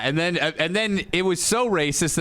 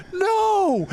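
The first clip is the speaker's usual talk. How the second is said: crowd yell; 13 semitones higher than usual. Retold or imitated. Crowd booing the wrestler, high mock yell. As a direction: shout it up high like a booing fan. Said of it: "the crowd's yelling".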